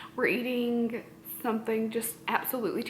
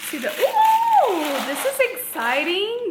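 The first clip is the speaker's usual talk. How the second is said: excited ooh; 9 semitones higher, 6 dB louder, slower than usual. Real, not acted. Opening an exciting gift.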